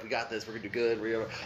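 quiet, reassuring encouragement; soft coaching. Retold or imitated. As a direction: softly talk her through it. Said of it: "I'm trying to coach her through it".

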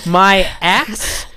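slow, laughing, hammed-up. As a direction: draw it out through laughter. Laughing hammy bit.

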